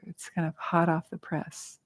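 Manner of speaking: normal talking voice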